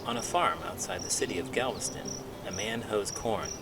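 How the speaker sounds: put-on steady read-aloud for children; picture book read to young listeners; story narration. A narrator's reading.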